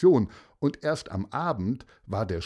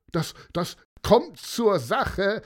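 crowd calling out; 10 semitones higher, 4 dB louder, slower than usual. Acted. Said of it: "riefen die Demonstranten".

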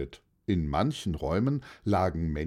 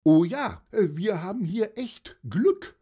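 put-on high-pitched happy old aunt; pleased auntie, pitched way up; delighted; 11 semitones higher, 4 dB louder than usual. A narrator's reading. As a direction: gush with joy in a high aunt voice. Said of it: "freute sich Dorothee".

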